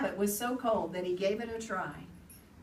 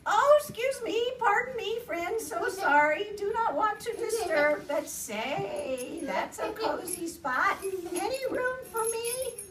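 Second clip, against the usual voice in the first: very high, fluttery apologetic voice; fussy polite hedgehog; 11 semitones higher, 5 dB louder than usual. Acted.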